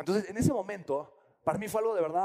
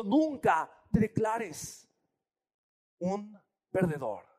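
solemn slow; much slower than usual. Retold or imitated. Friend's slow solemn advice.

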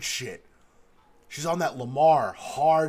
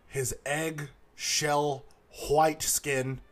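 ordinary talk, nothing marked

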